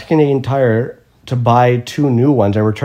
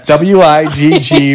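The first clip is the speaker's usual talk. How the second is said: slow, letter-by-letter spelling, a bit louder; 3 semitones higher, 6 dB louder, slower than usual. Retold or imitated. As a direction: spell each letter slowly and clearly. Customer spells out his surname.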